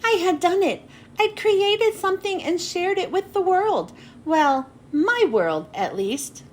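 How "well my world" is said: ordinary delivery, nothing marked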